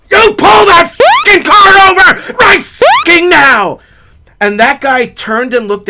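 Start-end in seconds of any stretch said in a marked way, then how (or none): 0.1-3.8 high, loud, drawn-out roadside yell; furious yelling. Retold or imitated. Driver yelling at a fleeing car's window, furious. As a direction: yell the order with full force. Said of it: "I start yelling".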